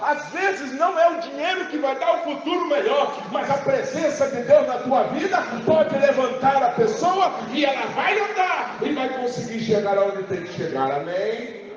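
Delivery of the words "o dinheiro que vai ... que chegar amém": preaching. Acted. Preaching climax.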